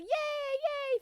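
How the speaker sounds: very high mock cheer. Crowd singing and jumping, high mock cheering. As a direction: imitate a happy crowd in a high voice. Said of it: "everybody's just like".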